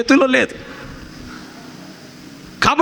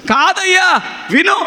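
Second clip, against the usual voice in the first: put-on high, raised protest of an old woman; insistent caller; 8 semitones higher, slower than usual. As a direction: cut the pastor off, insisting. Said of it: "ఇంత గట్టిగా మాట్లాడుతుంది?".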